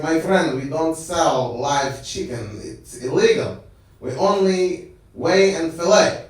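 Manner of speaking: put-on slow, measured Arabic-accented shopkeeper; deliberate mock Arabic accent. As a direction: imitate an Arabic shop owner firmly explaining. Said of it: "run by some Arabic dude".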